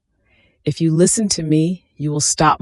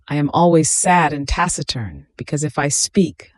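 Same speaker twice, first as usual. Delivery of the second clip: ordinary talk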